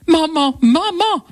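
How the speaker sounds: high relieved cries of Mom; crying out. A narrator's reading. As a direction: call out to her mother in relief. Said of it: "crie sa fille". (la fille crie vers sa mère, voix aiguë)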